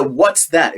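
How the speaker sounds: "what's that" said with the pitch falling at the end; falling tune. Shown as a demonstration. Lecturer demonstrates the clip's question with falling intonation. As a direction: let "what's that" drop down at the close, like a statement. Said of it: "you can actually hear that it's going down".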